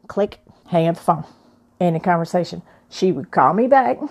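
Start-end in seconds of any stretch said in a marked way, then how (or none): none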